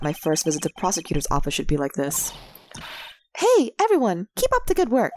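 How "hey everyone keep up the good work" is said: put-on high, bright young girl; perky girl voice. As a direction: chirp it like an eager girl. Voicing cheerful young girl character.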